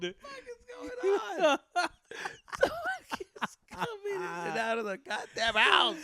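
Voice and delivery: high, loud, slow whining yell; mock outraged yell. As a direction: complain about intruders at the top of your voice. Playing the exasperated mom.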